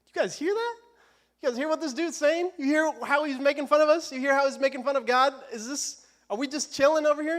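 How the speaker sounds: high-pitched, disbelieving questions; incredulous double take. Retold or imitated. Preacher as young David questioning his brothers, incredulous. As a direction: ask as if you can't believe your ears. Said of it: "doing a little double take".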